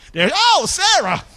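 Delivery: loud, high excited cry of Sarah; excited call. Acted. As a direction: call her name out with delight. Exclaiming the name loudly.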